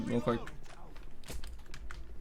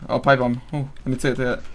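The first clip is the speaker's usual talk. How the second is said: usual speaking voice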